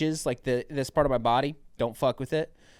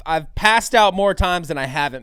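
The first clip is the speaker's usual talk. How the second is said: louder, emphatic insisting; insistent warning; 4 semitones higher, 10 dB louder than usual. Retold or imitated. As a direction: make him believe you. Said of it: "I was like".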